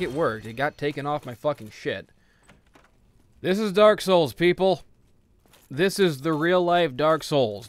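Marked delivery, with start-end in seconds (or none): 3.4-7.7 louder, mock-grand declaration; booming pronouncement, slower at the end; loud proclamation. Real, not acted. Commentator announcing to his viewers, loudly proclaiming.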